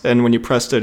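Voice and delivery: normal talking voice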